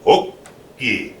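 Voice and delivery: "Oki" in two parts, a short stop before "ki"; proper pronunciation. Shown as a demonstration. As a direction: say "Oki" carefully, syllables held apart. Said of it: "say it properly".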